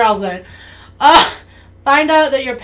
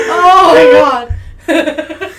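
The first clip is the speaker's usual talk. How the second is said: slow laughing groan; 4 semitones higher, 8 dB louder, much slower than usual. Real, not acted. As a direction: drag it out through laughter. Laughing hard.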